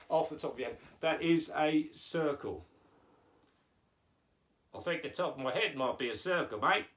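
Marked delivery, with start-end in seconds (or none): 4.8-6.9 quick put-on Aussie puppet; hammed-up Australian character; Australian accent. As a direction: rattle it off as an Australian. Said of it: "you're going with the Australian accent".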